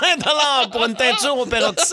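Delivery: laughing. Real, not acted. Hairdresser jokes about cheap perm.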